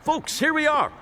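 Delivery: high, chirpy announcement; announcing arrival. Acted. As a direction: call out cheerily to the group. Tour guide announcing arrival.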